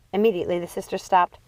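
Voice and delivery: plain reading voice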